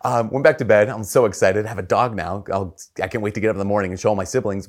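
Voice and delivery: ordinary talk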